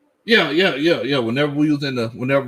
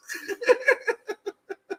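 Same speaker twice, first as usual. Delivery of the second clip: high laugh; 8 dB quieter than usual. Real, not acted. Laughing after punchline.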